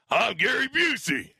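Gary Busey. Shown as a demonstration. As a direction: demonstrate the impression, drawn out. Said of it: "How does that sound like this?".

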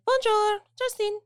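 high, sing-song fake French phone greeting; mock French greeting. Restaurant staff answering phone in mock French accent. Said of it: "they act like they're French".

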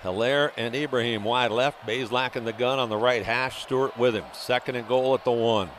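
ordinary talk, nothing marked

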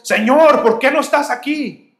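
high, loud desperate plea; anguished cry to God. Retold or imitated. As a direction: cry out to the Lord from the fall. Fallen believer cries out. (grito angustiado al Señor)

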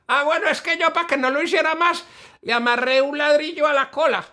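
high fool's voice, proud of his trick; high silly Bobo voice. Acted. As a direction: say it like a pleased simpleton. Bobo character voice. (bobo orgulloso con voz aguda)

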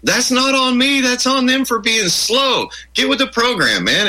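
mock exasperated friend telling them off; imitating exasperated friend. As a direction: scold them for being slow. Friend scolding the complainers.

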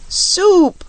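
high, loud one-word shout; storybook cry. A narrator's reading. Guard of Scoodlers answering their Queen, a high loud cry. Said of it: "cried the guard of Scoodlers".

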